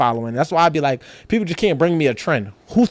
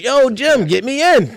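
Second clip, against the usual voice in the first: higher-pitched casual holler; calling out; 6 semitones higher, slower than usual. Acted. Man at the door hails promoter friend, calling out. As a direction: hail a buddy for a favor.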